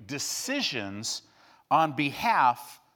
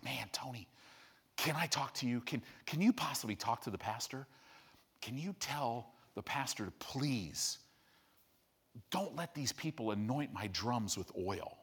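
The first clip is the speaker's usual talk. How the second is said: low, much softer pleading voice; hushed plea; 7 semitones lower, 12 dB quieter than usual. Retold or imitated. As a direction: beg quietly like a worried drummer. Drummer pleads with Tony.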